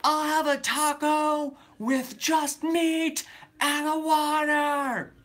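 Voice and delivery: very high, very slow drawn-out squeak; silly prank voice. Retold or imitated. Teen pranking the worker face to the speaker, very high and slow. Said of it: "And I was like".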